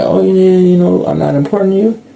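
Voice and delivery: put-on, high-pitched complaint; mock complaint. Mimics people complaining they're unimportant.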